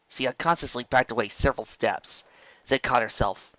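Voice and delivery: plain reading voice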